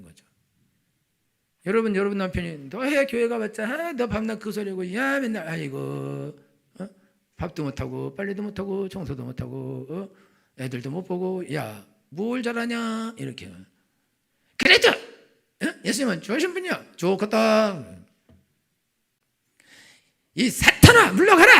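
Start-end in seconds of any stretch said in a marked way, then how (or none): 2.7-13.1 mock nagging, voice swooping high; jeering husband. Husband deriding his wife's churchgoing, jeering. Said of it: "남편이 이렇게 조롱하고". 14.6-17.8 high, loud mock outburst; acted marital taunt. Spouses quarrelling over Jesus, taunting. 20.3-21.5 very high, full-force mock shriek; exorcising shout. Wife banishing her husband as Satan, shrieking.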